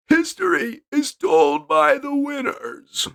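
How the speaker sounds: high, slow, drawn-out proclamation; high slow dramatic voice. Acted. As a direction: intone it like a legend. Dramatic quote in put-on voice.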